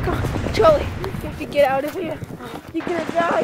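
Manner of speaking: urgent pleading shout. Acted. Pulling wounded partner out.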